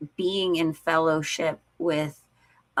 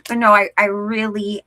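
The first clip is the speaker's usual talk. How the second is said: normal talking voice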